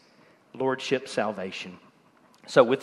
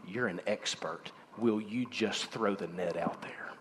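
soft, hushed voice of the Lord; gentle whisper; 8 semitones lower, 15 dB quieter than usual. Pretend. The Lord softly urging the doubter in a whisper. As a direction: breathe it like a tender invitation.

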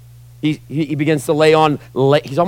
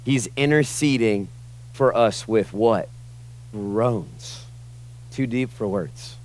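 hushed awe; 5 semitones lower, 9 dB quieter, slower than usual. Real, not acted. Solemn hushed climax.

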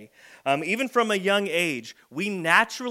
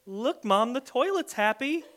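put-on a bright, higher kid voice; cheerful kid; 7 semitones higher than usual. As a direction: sell the mess as a happy thing. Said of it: "And he goes".

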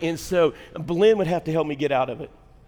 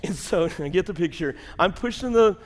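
usual speaking voice

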